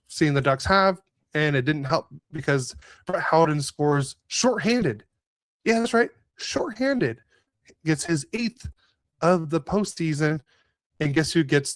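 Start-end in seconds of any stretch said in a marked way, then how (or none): 4.3-5.0 SHORTHANDED swooped up high, then falling; "shorthanded" sung out high in disbelief; incredulous emphasis. Real, not acted. Disbelief at goal conceded shorthanded. 6.4-7.2 "shorthanded" repeated high with a swooping fall; incredulous emphasis. Real, not acted.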